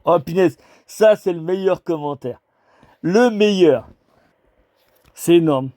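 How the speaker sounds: thrilled outburst at a comment; excited. Real, not acted. Delighted by a funny comment.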